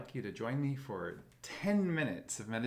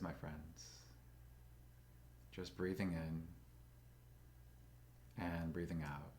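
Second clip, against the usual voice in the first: slow, calm meditation guide with long pauses; soothing, even voice, leaving space for breath; guided meditation; 2 semitones lower, 5 dB quieter than usual. Acted. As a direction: guide them gently into stillness, unhurried.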